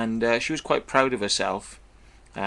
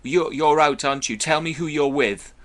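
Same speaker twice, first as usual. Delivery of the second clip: higher, louder imitation of an accusing woman; mock demanding caller; 5 semitones higher, 6 dB louder than usual. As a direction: bark out her jealous questions. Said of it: "It's like".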